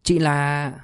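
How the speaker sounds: hesitant, drawn-out question; a touch of timid uncertainty. A narrator's reading. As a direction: ask shyly, leaving it hanging. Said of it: "rụt rè hỏi".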